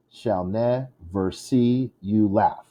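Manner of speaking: slow, beat-by-beat "shall never see you laugh"; metered reading. Shown as a demonstration. Teacher marking the rhythm, slow measured scansion. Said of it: "a rhythm like that".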